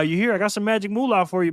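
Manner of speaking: usual speaking voice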